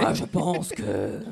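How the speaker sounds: very slow, warped voice; slowed-down glitchy voice. Real, not acted.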